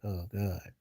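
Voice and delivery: low-volume, appalled aside; quiet dismay. Retold or imitated. Guest recalling the pile on the carpet, quietly dismayed. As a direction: retell a private reaction, very softly. Retold reaction to the dog mess.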